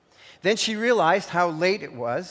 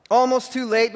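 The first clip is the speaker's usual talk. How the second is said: ordinary talk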